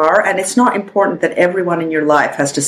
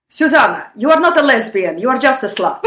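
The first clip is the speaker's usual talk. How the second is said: mock-stern Russian-accented mother, higher at first; mother's Russian accent; 5 semitones higher than usual. Daughter quoting her mother's reply to coming out, Russian-accented putdown. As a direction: imitate a blunt Russian mom laying down the verdict. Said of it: "here's an example of my mother".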